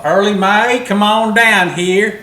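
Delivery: high loud mock lady call; sing-song woman inviting; female voice. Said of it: "Sounds like a female".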